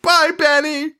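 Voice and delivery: put-on very high, whiny kid voice; kids' goodbye. Kids waving back at the taxi driver, high teary kid voice. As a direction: squeak it like teary children. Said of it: "And they're like".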